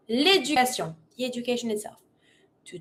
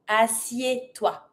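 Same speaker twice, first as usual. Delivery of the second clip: slow, lower teaching take; slow repeat; 3 semitones lower, 4 dB quieter, slower than usual. Shown as a demonstration. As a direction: spell out the syllables unhurriedly. Slower repeat for learners.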